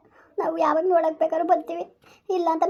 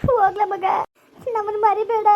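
higher, anguished goodbye; tearful farewell; 4 semitones higher, 4 dB louder than usual. Acted. Parent asking departing son not to forget them, tearful farewell. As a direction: call out a grieving farewell.

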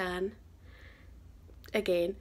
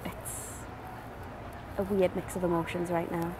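subdued, a little overwhelmed; slightly emotional; 5 dB quieter than usual. Real, not acted. Vlogger overwhelmed after meeting her hero, slightly emotional. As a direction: let it out softly, holding back tears. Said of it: "slightly emotional, shall we say".

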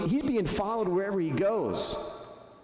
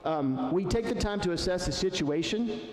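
normal talking voice

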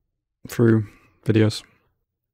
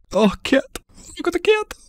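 much higher, cutesy voice for a cat; cooing at cat. Real, not acted. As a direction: coo at the kitty.

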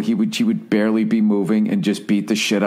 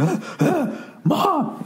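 high, mock panicked child calling for mom; hammed-up kid under a big brother; whiny kid cry; much slower than usual. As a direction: squeal for mom like a trapped little brother.

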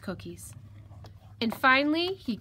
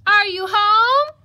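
high, loud, drawn-out shout; yelled call; 12 semitones higher, 15 dB louder, slower than usual. Acted. Visitor outside calls to whoever is home, yelling. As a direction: holler it slowly, rising on the last word. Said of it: "someone yelled outside".

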